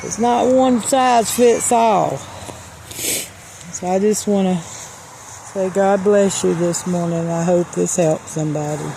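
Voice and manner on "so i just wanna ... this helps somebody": closing blessing. Real, not acted. Closing blessing, soft and slow.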